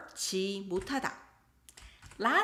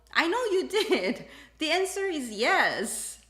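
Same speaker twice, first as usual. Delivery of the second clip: laughing; 8 semitones higher, 3 dB louder than usual. Real, not acted. Teacher laughing at the marital war.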